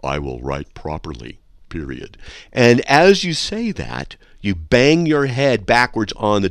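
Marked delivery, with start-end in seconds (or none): 0.0-2.2 quiet inner sentence. Shown as a demonstration. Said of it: "you think a sentence like this".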